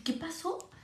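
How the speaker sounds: hushed answer to grandma; whisper. Retold or imitated. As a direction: murmur it under your breath.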